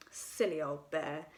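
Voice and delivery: fond gentle tease; loving. A narrator's reading. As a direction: Christopher Robin to stuck Pooh, loving. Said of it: "in such a loving voice".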